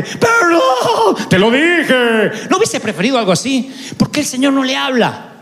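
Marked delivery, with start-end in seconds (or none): 0.0-2.5 high, wailing mock sob with a laugh; mock crying. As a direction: whine it out like a hurt, crying accuser. Mock Jesus scolding Peter.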